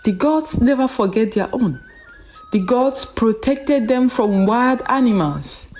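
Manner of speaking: ordinary delivery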